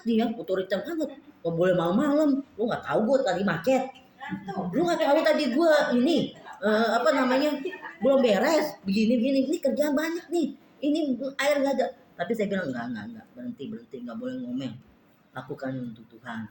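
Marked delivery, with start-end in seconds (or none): 1.4-11.9 louder, higher, rising irritation; grumbling complaint. Pretend. Grumbling to herself about unfair orders, louder and irritated. 12.7-16.4 lower, much softer, hushing yourself; calming self-talk. Pretend. Quietly reining herself in from grumbling, soft and low.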